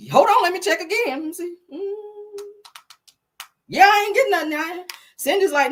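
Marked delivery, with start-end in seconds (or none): 0.1-2.4 higher, fussy Bob checking his phone; a flustered man double-checking; imitating Bob. 3.7-4.5 higher, louder Cindy on the phone; blunt friend reporting nothing came; imitating Cindy.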